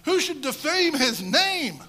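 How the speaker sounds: loud, high, slow triumphant declaration; a champion proclaiming his God over the fallen giant. Retold or imitated. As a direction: ring it out like a victory proclamation. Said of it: "He goes".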